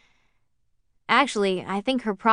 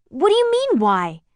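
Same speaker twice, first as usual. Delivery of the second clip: John snaps back, louder and higher; raised voice; 9 semitones higher, 5 dB louder than usual. Acted. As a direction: lift the voice, defensive and stalling. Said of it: "He raised his voice".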